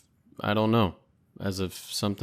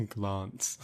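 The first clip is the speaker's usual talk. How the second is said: mock NPC accent; 6 dB quieter than usual. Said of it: "I said it in his accent".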